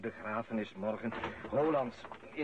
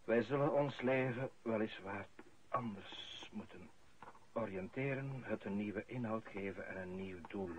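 solemn eulogy; 4 semitones lower, 5 dB quieter than usual. Acted. Priest at the funeral, a solemn eulogy. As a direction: speak as if over a grave, softly.

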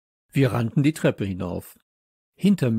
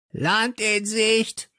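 high, drawn-out squawking call; parrot squawk; 11 semitones higher, much slower than usual. A narrator's reading. Parrot suddenly heard by the children, squawked call. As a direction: squawk it slowly like a parrot lookout.

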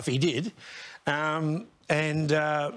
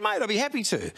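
higher mock Farnham voice; imitating Farnham; 9 semitones higher, faster than usual. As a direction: repeat his offer in his pitched-up voice. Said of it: "He said".

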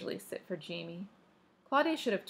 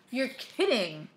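higher, incredulous exclamation; astonished exclamation; 4 semitones higher, 3 dB louder, slower than usual. A narrator's reading. Claudia hearing who is on the phone, astonished. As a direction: exclaim it in disbelief. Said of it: "exclaimed Claudia".

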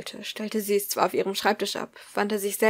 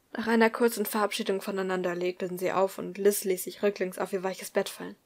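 ordinary delivery, nothing marked

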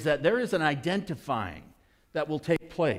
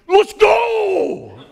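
much higher, much louder shout; wild cheer; 16 dB louder than usual. Retold or imitated. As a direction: yell it like your kid just scored. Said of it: "And I'm freaking out".

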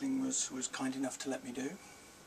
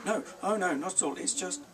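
flustered denial; 7 semitones higher, 6 dB louder than usual. A narrator's reading. Boy hurriedly denying.

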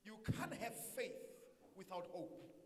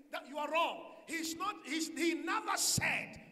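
shouted preaching; 4 semitones higher, 7 dB louder than usual. Acted. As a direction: shout the correction at the class. Shouting correction at class.

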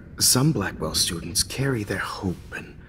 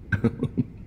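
a little amused snicker at her pain. Acted. Villain gloats at Max's reaction.

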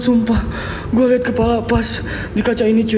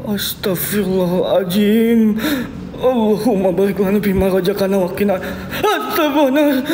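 fearful prayer. Real, not acted. Prays in fear after the scare.

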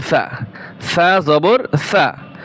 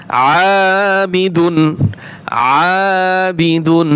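আবিদুন stretched out slowly; teacher's slow recitation; slow model reading; much slower than usual. Shown as a demonstration. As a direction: draw out আবিদুন syllable by syllable. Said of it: "teacher models the word slowly".

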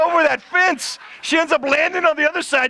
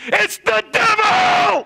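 screamed warning; 9 semitones higher, much slower than usual. Retold or imitated. Someone in the crowd screams at the noise, panicked. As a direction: yell it like the devil just arrived. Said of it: "Somebody screams".

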